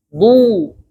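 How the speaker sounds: "Bu" with the lips rounded as far as they go; "Bu" with lips pushed forward as if for a kiss; fully rounded bu. Shown as a demonstration. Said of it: "membulatkan bibir sebulat-bulatnya".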